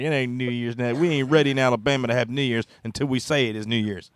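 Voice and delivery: put-on southern good old boy. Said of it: "He's like".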